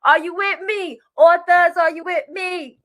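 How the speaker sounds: loud, high call to the crowd. Real, not acted. Host calling out to her authors to rally them. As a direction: shout their name to get them going.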